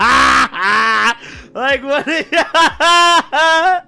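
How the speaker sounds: loud, high, helpless laughter; cracking up. Real, not acted. Storyteller reliving his friend's reckless remark, cracking up. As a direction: laugh too hard to get the words out.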